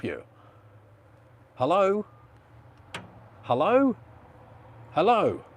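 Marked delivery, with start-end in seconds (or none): 1.5-5.4 raised, higher calling hello; louder, high-pitched checking if anyone's there; calling down phone. Real, not acted. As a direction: call out to a caller you can't hear. Calling down a silent phone line.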